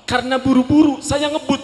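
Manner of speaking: story speeding up, high and loud; excited rush. Retold or imitated. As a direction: rush the words like racing. Rushing story climax.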